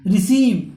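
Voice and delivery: रिसीव with a long, stressed ee; teacher's model रिसीव, long ee; long ee pronunciation. Shown as a demonstration. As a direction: draw out the ee in रिसीव. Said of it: "बड़ी ईटा मात्रा आ रहा है".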